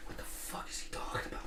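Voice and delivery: breathy whisper of disbelief; whispered aside. Retold or imitated. Soldier in the turret, baffled by the officer, in a whisper. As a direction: hush it, like you don't want the officer to hear.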